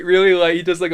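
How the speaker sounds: usual speaking voice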